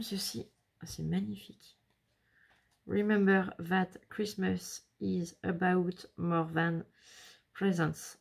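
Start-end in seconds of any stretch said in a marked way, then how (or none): 2.9-8.2 slow English with a French accent; bad English accent. Real, not acted. Reading the album upside down, rotten French-accented English. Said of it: "avec mon accent anglais pourri".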